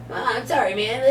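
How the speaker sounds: sheepish stoner. Retold or imitated. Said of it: "High-pitched voice and everything".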